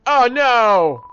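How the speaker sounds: high, loud cry of dismay; alarmed shout. Real, not acted. Player reacting to game fight, alarmed shout. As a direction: yell it in sudden alarm.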